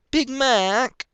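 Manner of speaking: high, loud angry yell of his name. A narrator's reading. Filly yelling at her older brother, loud and high. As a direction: yell at your brother for spoiling it. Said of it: "Applejack yelled".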